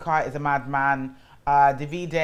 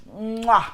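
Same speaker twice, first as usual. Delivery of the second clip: big, higher, louder smacked kiss noise; chef's-kiss mwah; 6 semitones higher, 5 dB louder than usual. Real, not acted. Praising the outfit she came back in, exaggerated mwah. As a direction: blow an emphatic kiss of approval.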